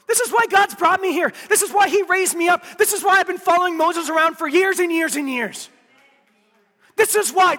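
loud, driving sermon voice; a preacher voicing Joshua's resolve to the church; full-force preaching. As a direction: preach it with all you've got.

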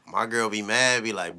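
ordinary talk, nothing marked

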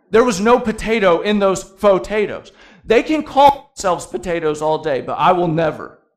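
loud, hammed-up protest; comic indignant rant; mock indignation. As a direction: declare it like an outraged potato purist. Comic rant about fake potatoes.

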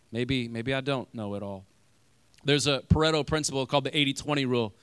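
normal talking voice

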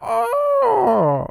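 very high mock German squeal; shriek-pitched cartoon German; shrill German accent. Co-host showing how Jake's accent went wrong, shrill German. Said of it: "really shrill".

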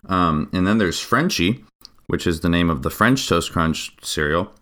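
ordinary delivery

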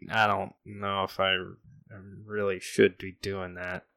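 reluctant cop, soft and unsure; hesitant. Acted. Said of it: "The cop seems hesitant".

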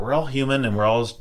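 ordinary talk

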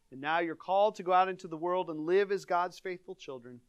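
solemn blessing voice, rising on the Father; formal closing blessing over the congregation; benediction. Acted. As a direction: bless the people with full warmth.

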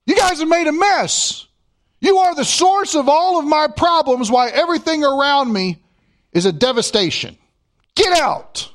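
hammed-up furious king shouting them out; Pharaoh telling them to leave. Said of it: "He's gonna say".

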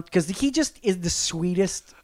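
ordinary delivery

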